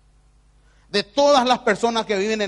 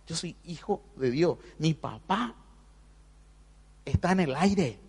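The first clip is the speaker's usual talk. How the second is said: softer, hushed prayer to the Lord; morning prayer; 7 dB quieter than usual. Retold or imitated. Preacher retells his morning prayer, softly and hushed. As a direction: murmur it like a private prayer. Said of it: "a la mañana siempre digo eso".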